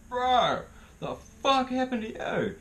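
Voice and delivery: high, loud stunned outburst; incredulous shock. Retold or imitated. Teller acting out his reaction to a battered guy, shocked. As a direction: blurt it out in high-pitched disbelief.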